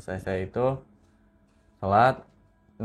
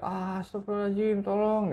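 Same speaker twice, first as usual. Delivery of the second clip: mock scream; 10 semitones higher than usual. Friend alone in the room calling for help, mock scream. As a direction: imitate a frightened scream at held-back volume. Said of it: "Dia teriak-teriak sendiri di kamar".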